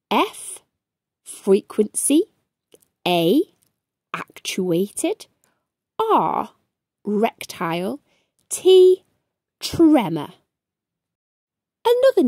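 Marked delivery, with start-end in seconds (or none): none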